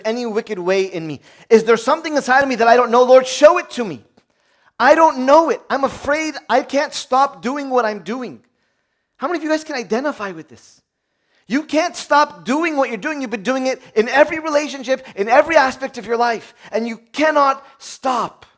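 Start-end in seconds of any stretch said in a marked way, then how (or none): 1.5-8.0 high, loud pleading to God; rising desperate plea; fervent outcry. Acted. Preacher crying out to God. 11.5-18.4 full-force preaching. Acted.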